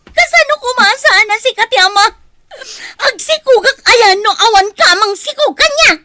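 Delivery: high, loud crying wail; loud anguished weeping; wailing sob. Acted. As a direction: sob it out at full force. Woman wails after being abandoned.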